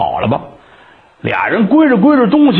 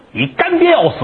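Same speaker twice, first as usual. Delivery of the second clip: high, hurried alarm; urgent call; 11 semitones higher than usual. Acted. Neighbor's boy spotting Qin Zhong in the street, urgent high call. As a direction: blurt the news, pitched way up.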